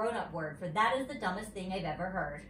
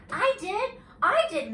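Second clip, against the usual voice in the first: high, louder eager calling out; excited yell; 12 semitones higher, 7 dB louder than usual. A narrator's reading. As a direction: shout it, dying to be picked. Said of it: "I yelled real excited".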